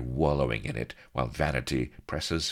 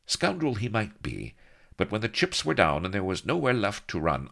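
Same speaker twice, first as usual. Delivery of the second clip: usual speaking voice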